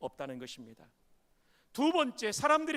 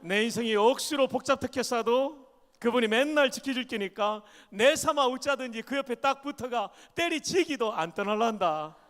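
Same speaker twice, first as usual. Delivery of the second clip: Gyeongsang dialect reading kept going; 3 semitones higher, 6 dB louder than usual. Shown as a demonstration. Pastor carries on the psalm in Gyeongsang dialect. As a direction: finish the psalm in broad Gyeongsang speech. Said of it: "경상도 버전입니다".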